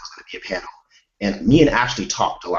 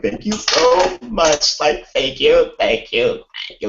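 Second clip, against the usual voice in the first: gushing thanks; 7 semitones higher, 4 dB louder, slower than usual. Retold or imitated. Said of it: "we did not stop thanking".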